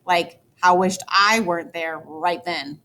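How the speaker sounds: ordinary delivery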